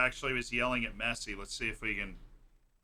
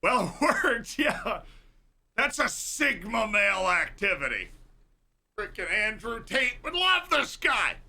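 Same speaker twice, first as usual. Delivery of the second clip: mock hype; 8 semitones higher, 4 dB louder than usual. Real, not acted. Mocking alpha male hype.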